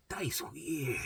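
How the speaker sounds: mom's hushed "That is weird". Retold or imitated. Mom judging symptoms, hushed mock voice. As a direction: mutter it like a worried mom. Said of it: "she's going".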